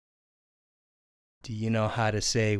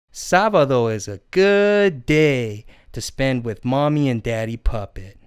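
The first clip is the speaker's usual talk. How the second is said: usual speaking voice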